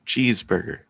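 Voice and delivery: plain American cheeseburger; straight American. Acted. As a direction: say it flat Midwestern American. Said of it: "He's going straight American".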